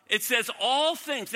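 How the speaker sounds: slow, emphatic all; stress on all. Acted. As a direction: land the word all hard. Correcting the misquote.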